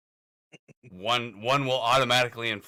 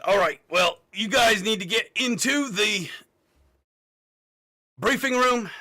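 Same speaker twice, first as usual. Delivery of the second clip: commanding officer; 7 semitones higher, 4 dB louder than usual. Acted. Officer orders the party out.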